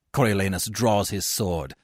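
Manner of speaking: ordinary delivery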